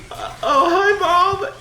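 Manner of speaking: high, loud mock-innocent kid voice; hammed-up little brother caught out; caught child. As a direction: imitate a bawling kid abruptly switching to a greeting. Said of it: "he stops crying immediately".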